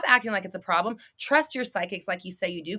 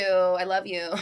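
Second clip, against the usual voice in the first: ordinary talk, nothing marked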